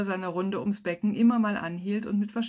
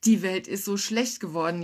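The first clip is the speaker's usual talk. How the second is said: ordinary delivery, nothing marked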